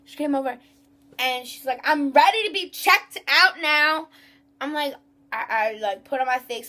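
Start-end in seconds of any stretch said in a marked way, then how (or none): 1.8-4.1 high, loud, slow mimicry; mocked demanding customer. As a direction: drag out an entitled customer's demand, mockingly. Mocks the customer who angered her.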